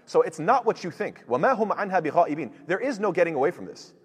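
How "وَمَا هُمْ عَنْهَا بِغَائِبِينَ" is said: fast, chanted recitation, a little louder; Quran recitation. Acted. Teacher reciting the verse to students, chanted and fast.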